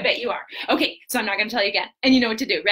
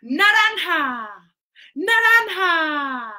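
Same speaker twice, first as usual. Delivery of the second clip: Naranja drawn out slowly and loud; emphatic Naranja; 5 semitones higher, 4 dB louder, much slower than usual. Shown as a demonstration. Teacher models Naranja for the kids, slow and emphatic. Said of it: "Okay, you ready?".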